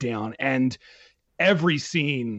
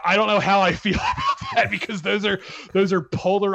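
higher-pitched, laughing through the words; real laughter; 6 semitones higher, 3 dB louder than usual. Real, not acted. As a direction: let the laugh break into the sentence. Laughs over Joker versus Mister Rogers.

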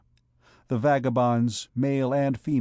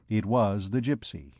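ordinary delivery

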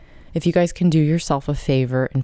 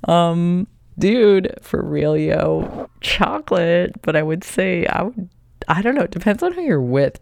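playful excited; 5 semitones higher, 3 dB louder than usual. Real, not acted. Joking animated answer.

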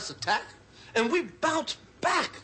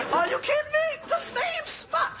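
outraged disbelief; 12 semitones higher than usual. Acted. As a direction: squeal it in disbelief. Outraged at rebuilding there.

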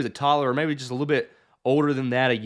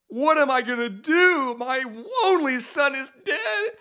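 distraught mother; 10 semitones higher than usual. Retold or imitated. Mother over her dead son.

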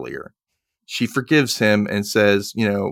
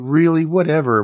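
dismissive; 6 semitones higher, 5 dB louder than usual. Retold or imitated. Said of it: "she's like".

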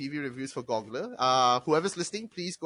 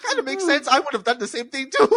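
high mock thought; 9 semitones higher, 5 dB louder, faster than usual. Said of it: "I'm like".

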